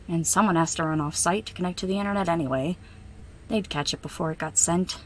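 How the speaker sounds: usual speaking voice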